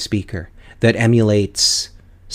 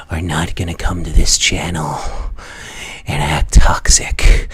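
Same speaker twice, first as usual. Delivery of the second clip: menacing whisper; 4 semitones lower, 8 dB louder, slower than usual. Real, not acted. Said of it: "threatening toxic players".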